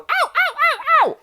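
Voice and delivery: very high, squeaky yelping ows; high-pitched ow. Pretend. Owner yelps high-pitched ows to stop the biting puppy. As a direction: yelp like a hurt littermate. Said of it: "in a high-pitched voice".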